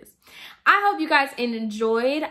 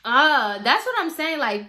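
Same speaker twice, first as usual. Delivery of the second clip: usual speaking voice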